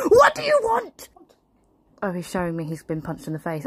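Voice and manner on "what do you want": much higher, shrill exasperated demand. Acted. Mother losing patience with her son, exasperated. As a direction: explode in disbelief.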